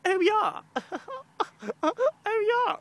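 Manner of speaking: very high, loud mocking "oh yeah"; squealed mock of a put-on accent; stupid put-on voice. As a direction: exaggerate an affected voice, way up high. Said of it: "they put on this stupid voice".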